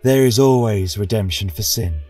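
ordinary delivery, nothing marked

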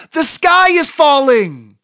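high, loud, slow alarm; panicked cry. Acted. Chicken Little frets that the sky falls, high and loud.

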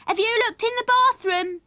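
put-on high, loud child-character question; calling out. A narrator's reading. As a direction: call across the house in a small boy's voice. Sammy calls out during frantic search.

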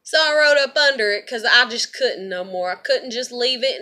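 ordinary talk

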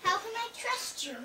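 high piglet question at normal volume; lightly acted piglet doubt. Pig repeats doubt, softer.

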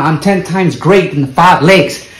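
ordinary talk

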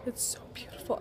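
soft awed whisper. Real, not acted. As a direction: breathe it out in wonder. Whispering over the book.

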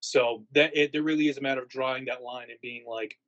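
usual speaking voice

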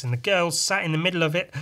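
usual speaking voice

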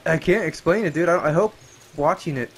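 ordinary talk, nothing marked

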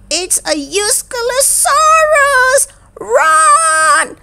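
very high storybook scream of warning; alarmed shout. A narrator's reading. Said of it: "shouted Posey and Malik".

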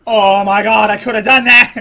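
loud cry, rising toward laughter; exasperated outburst. Real, not acted. Player missing an easy move, loudly exasperated.

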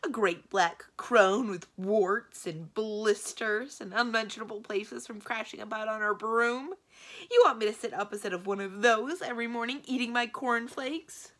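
loud, high-pitched indignant rant; outraged yelling. A narrator's reading. Magician rejects marrying a witch, outraged yelling. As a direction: rail in horror at the thought. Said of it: "yelled Araman".

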